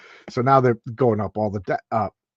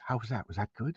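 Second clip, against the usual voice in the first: much softer, hushed after the act; quiet check-in; 3 semitones lower, 13 dB quieter than usual. Retold or imitated. Commissioner quietly asking the cop if it worked, hushed. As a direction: drop to a shy murmur.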